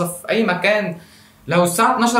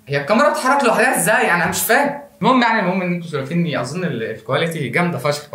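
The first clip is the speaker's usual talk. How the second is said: ordinary delivery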